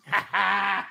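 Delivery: open, amused burst of laughter; real laugh. Real, not acted. Amused at a listener's comment.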